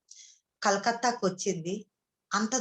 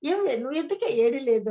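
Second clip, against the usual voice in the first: husband teasing; 3 semitones higher, 3 dB louder than usual. Retold or imitated. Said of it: "మా ఆయన అయితే ఏమంటున్నాడు".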